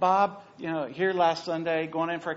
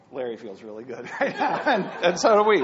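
laughing; 7 dB louder than usual. Real, not acted. Laughter during the anecdote.

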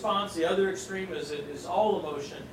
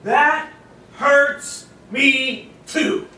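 loud, high, very slow anguish; Jesus sharing the sisters' grief, slow and pained; 8 semitones higher, 12 dB louder, much slower than usual. Retold or imitated. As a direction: drag out the hurt. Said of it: "You know what he means?".

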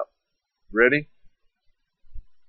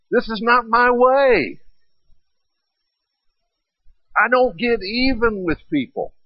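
a lightly voiced Jesus, higher and firm; voicing Jesus rebuking; 7 semitones higher than usual. Jesus scolding Peter after the ear, higher and firm. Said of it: "Jesus looks right at him and says".

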